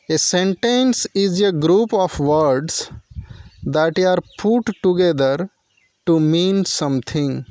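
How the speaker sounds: very slow, word by word definition; slow dictation. Shown as a demonstration. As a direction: dictate it so students can write. (धीमे-धीमे लिखवाने वाला अंदाज़)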